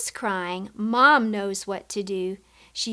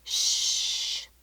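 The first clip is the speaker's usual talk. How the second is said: library shush. Shown as a demonstration. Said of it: "the librarian will say".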